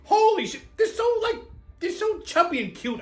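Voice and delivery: high squeal over something cute; gushing. Real, not acted. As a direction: coo like it's adorable. Gushing over tiny shopping carts.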